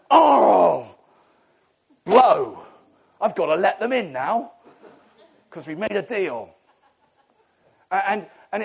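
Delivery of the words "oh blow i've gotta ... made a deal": loud groaning "Oh, blow", then laughing grudging concession; a put-upon God forced to let them in; exasperated God. Retold or imitated. God, annoyed, groaning that he must keep the deal. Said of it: "And God's going".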